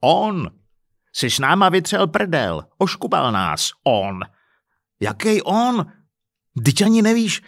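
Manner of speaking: scornful, heated interruption; angry outburst. Acted. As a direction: snap back in exasperation. Husband angrily cuts in, acted quarrel.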